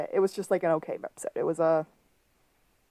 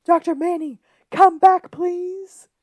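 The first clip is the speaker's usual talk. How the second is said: desperate high scream, much louder; 12 semitones higher, 9 dB louder than usual. Retold or imitated. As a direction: wail it after him, high and loud. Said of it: "a nice scream of".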